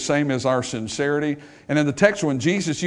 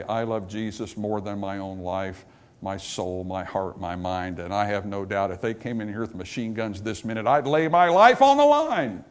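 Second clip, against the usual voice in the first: from hushed and low to a raised, drawn-out peak; building vow; 6 semitones lower, 3 dB quieter than usual. Retold or imitated. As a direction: start quietly, swell into a bold pledge. Voices a believer's bold vow, building.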